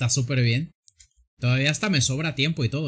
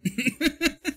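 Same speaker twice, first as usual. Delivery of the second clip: high giggling laugh; a sudden high laugh at the screen; burst of laughter; 13 semitones higher than usual. Real, not acted. Streamer cracking up at a friend's drawing, high laugh.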